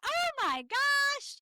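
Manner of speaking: very high, loud, drawn-out Southern exclamation; big Southern "oh my gosh" impression; Southern accent. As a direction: imitate an excitable Southern woman, slow and high. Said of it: "she talks in a real Southern accent".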